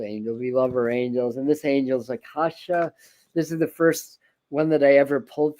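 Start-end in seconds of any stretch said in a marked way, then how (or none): 0.0-0.4 quiet, lower trailing repeat; soft fade. Acted. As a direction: let it fade away. Repeated word fading to soft.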